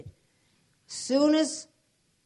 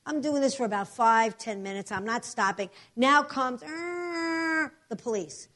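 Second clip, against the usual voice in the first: usual speaking voice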